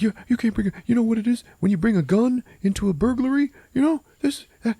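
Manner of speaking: nerdy guy in high falsetto; squeaky, stammering nervous lecture; Alex. Retold or imitated. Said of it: "who's, like, the nerdy guy".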